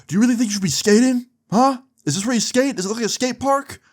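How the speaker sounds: mock an outraged mall cop; angry guard. Angry security guard scolding skater.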